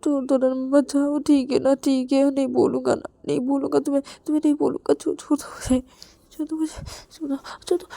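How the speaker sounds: high, pleading voice that cracks into a scream; begging, crying wife. Acted. Story figure begs to be released. (रोते हुए गिड़गिड़ाना)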